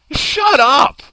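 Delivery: high, loud shut-down; comic ranting shout; exasperated outburst. Acted. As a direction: yell it at someone who isn't there. Rant addressed to absent George Takei.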